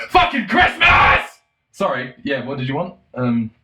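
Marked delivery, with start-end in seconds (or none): 0.1-1.4 high, slow, loud shriek; unhinged shout. Retold or imitated. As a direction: blurt it out like you've snapped. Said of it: "you're like".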